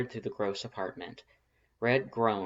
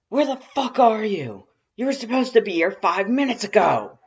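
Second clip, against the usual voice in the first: much higher, much louder furious yelling; angry rough shout; 12 semitones higher, 14 dB louder than usual. A narrator's reading. Furious brother yelling down the phone about lateness, angry shout. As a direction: bawl him out for being late. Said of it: "their voice rough and gravelly".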